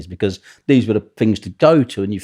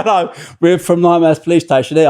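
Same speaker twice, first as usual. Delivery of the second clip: mock copper voice; 9 semitones higher, 7 dB louder than usual.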